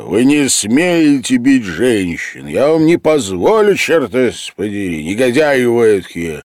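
громкий, высокий возмущённый окрик; заступись за женщин, что есть мочи; гневный крик. A narrator's reading. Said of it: "послышался голос художника". (художник громко возмущается, защищая женщин)

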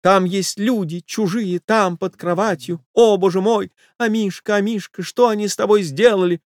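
high-pitched distraught mistress; high lament over a beloved pet; hysterical lady. Acted. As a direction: wail in a high lady's voice.

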